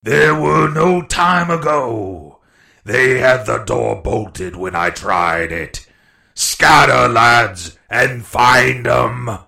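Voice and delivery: slow, drawn-out, loud commands; shouted orders. A narrator's reading. Blind pirate sending his lads searching, slow bellow. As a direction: bellow it slowly to the whole gang.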